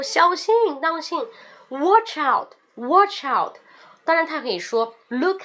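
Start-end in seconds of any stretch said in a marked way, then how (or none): 0.0-3.6 higher, shouted 小心; warning shout. Shown as a demonstration. Car is coming, shouted warning. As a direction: call out the danger. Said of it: "一辆车过来说".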